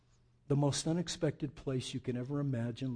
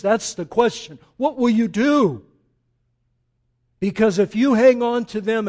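loud, high fire-and-brimstone preaching; full-force sermon cry; fiery preaching; 7 semitones higher, 13 dB louder than usual. Acted. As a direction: thunder it at the congregation.

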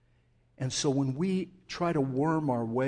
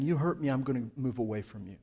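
quick cold retreat; 3 semitones lower, faster than usual. Shown as a demonstration. Preacher voicing the self-protective answer, fast dismissive. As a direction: toss it off quickly, already pulling away. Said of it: "Instead of saying".

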